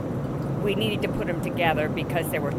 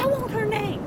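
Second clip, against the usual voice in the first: very high-pitched demand; hammed-up indignant imitation; mock outrage. Acting out indignant complaint.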